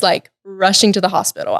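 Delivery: ordinary talk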